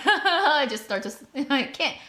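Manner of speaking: high, laughing and breaking off; can't hold the laugh in; helpless laughter. Real, not acted. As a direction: struggle to talk through real giggles. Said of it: "I can't control my face".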